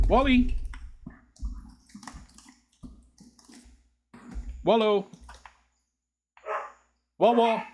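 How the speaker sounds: a sing-song shout to fetch the dog; calling the dog. Real, not acted. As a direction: call him by name like he's in the next room.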